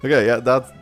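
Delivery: ordinary talk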